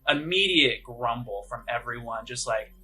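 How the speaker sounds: ordinary talk, nothing marked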